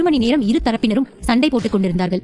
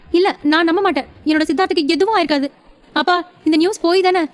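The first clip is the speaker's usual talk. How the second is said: put-on higher, fast, disbelieving young woman's voice; young wife denying the news to her father, higher; shocked young wife; 6 semitones higher than usual. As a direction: refuse to believe the news, voice pitched up.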